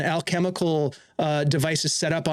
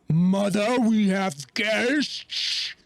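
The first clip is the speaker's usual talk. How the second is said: slow, high golem voice, dragging out guests; a hulking golem calling to mother; lumbering golem; 5 semitones higher, much slower than usual. Acted. Golem announcing guests to its mother, slow and strange.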